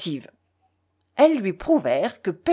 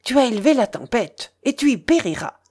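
usual speaking voice

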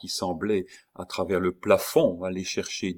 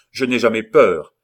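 loud proud defiance; defiant shout; 4 semitones higher, 11 dB louder than usual. Acted. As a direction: proclaim you fear nothing. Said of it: "dit Bussy". (défi crié sans peur)

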